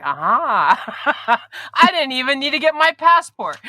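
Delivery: gleeful laugh. Pretend. Delighted imagined vlog viewer.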